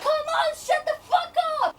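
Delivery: high, loud outburst at the inner voices; frustrated scream. Acted. Reader silences the voices in her head, frustrated scream. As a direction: scream it, fed up with the arguing. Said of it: "I'm screaming".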